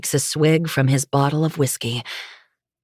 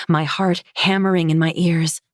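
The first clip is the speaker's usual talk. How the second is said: ordinary delivery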